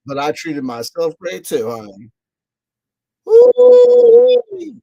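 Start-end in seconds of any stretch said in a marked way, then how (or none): 1.7-4.7 sassy squeal. Real, not acted. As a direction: squeal with delight at your own splurge. Gleeful self-treat exclamation.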